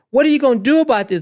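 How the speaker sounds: fast, high, loud demanding question; mock indignant challenge. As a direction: fire the question off in mock outrage. Mock quote of an official challenge.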